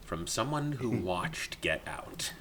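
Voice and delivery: very slow, hushed tagline parody; mock trailer voice. Host topping the joke tagline, mock trailer voice. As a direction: stretch it out like a film promo.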